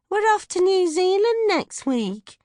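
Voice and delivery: high, loud, slow chirpy mum voice; mum's announcement. Acted. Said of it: "Mutty says".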